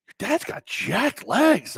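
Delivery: high, drawn-out mock amazement; slow, high-pitched teasing quote; daughter's friends. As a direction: imitate impressed kids, slow and swooping high. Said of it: "my daughter's friends were like".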